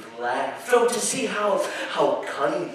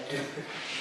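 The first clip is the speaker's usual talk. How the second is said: soft laugh; 12 dB quieter than usual. Acted. Narrator laughs at the listener.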